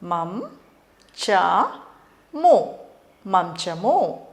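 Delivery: మంచము split మం చ ము, then said whole slowly; syllable by syllable. Shown as a demonstration. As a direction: sound it out for children. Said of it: "దీన్ని ఎలా పలకాలంటే". (అక్షరాలుగా విడదీసి నెమ్మదిగా)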